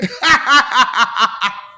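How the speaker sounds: loud staccato laugh; mocking cackle. Jeering at a state of mind, mocking cackle. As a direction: cackle in even, loud bursts.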